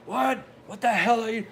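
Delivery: sharp, high, loud shout of disbelief; angry instructor shout. Retold or imitated. Instructor yelling at recruits walking off, an angry shout. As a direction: bark at the recruits like a drill instructor. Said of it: "and he goes".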